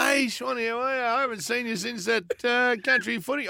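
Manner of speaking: mimicking a chatty pub greeting; imitating a mate. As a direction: play the friendly bloke who has no clue who he's talking to. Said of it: "I looked at Ralph and gone".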